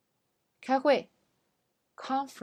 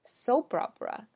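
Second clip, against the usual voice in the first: soap opera with SOAP punched higher; stress on SOAP; 3 semitones higher than usual. Shown as a demonstration. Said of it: "它的重音在前面".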